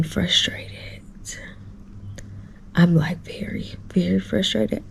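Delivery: hushed, frustrated whisper, voice dropped right down. Real, not acted. Hotel guest whispering her frustration to viewers. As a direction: whisper it through clenched frustration. Late night, venting frustration quietly.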